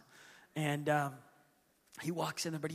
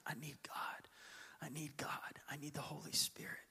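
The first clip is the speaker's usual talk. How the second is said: soft, breathy whispered prayer; a seeker whispering his need for God; whispered plea; 3 semitones lower, 13 dB quieter than usual. Acted. Voicing a hungry seeker.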